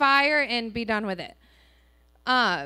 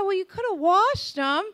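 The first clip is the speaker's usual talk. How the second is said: husband's voice; 7 semitones higher, 4 dB louder than usual. Retold or imitated. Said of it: "He said".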